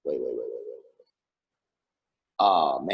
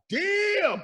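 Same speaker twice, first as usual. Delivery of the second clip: amazed. Retold or imitated. Reacting to the singing.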